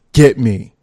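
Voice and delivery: loud, angry read of a text; mock-furious reading of a girlfriend's text; angry demand. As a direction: bark the text like she's fed up. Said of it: "She gets mad".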